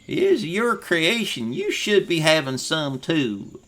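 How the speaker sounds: high, loud hearty farmer voice; barley farmer. Acted. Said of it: "and he".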